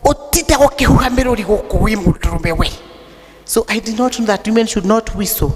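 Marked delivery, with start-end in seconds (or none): 0.0-2.7 scolding father. Retold or imitated. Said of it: "And he asked me".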